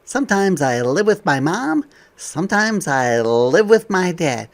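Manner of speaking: bright, lively picture-book voice; reading to children. Acted. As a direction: read it out to a class of kids.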